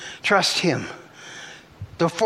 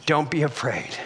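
lowered, soothing repeat; gentle reassurance; 4 semitones lower, 4 dB quieter than usual. Acted. Preacher repeating Jesus' words to the fearful, gentle reassurance. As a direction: bring it down low and reassure.

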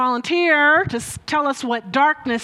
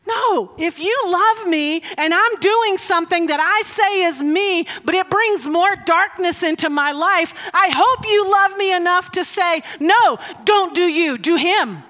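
raised, insistent preaching voice; emphatic rebuttal; 6 semitones higher, 4 dB louder than usual. Real, not acted. Preacher pleading to be loved enough, emphatic and raised. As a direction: push back hard, higher and forceful. Impassioned rebuttal of permissive love.

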